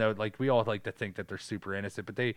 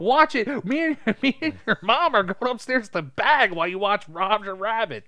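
higher, louder cheery parent voice; mock careless parents; 11 semitones higher, 6 dB louder than usual. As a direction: shoo the kids off with a grin. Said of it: "And they were like".

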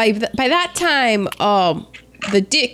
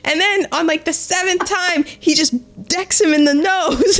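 ordinary delivery

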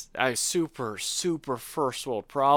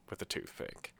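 whispered aside; 15 dB quieter than usual. Real, not acted. Trailing aside about cleaning cake pan.

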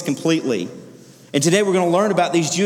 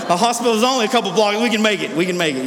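quick, higher urgent reassurance; hammed-up desperate hurry; urgent push; 5 semitones higher, faster than usual. Father to daughter in car, urgent rushed reassurance. Rushing to hospital.

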